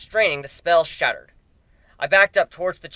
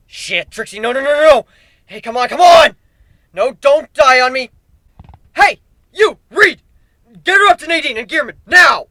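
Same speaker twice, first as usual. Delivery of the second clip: much higher, louder frantic pleading and shouting; desperate panic; 9 semitones higher, 12 dB louder than usual. Acted. Hero over his dying rider, a frantic shout for help. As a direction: beg her not to die, then yell orders.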